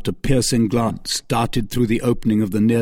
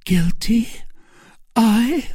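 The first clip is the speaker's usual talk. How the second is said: surprised; 12 semitones higher, slower than usual. Acted. Milady feigning innocence.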